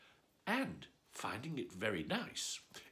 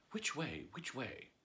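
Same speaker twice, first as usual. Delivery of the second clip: anxious; 6 dB quieter than usual. Acted. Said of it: "said anxiously to herself".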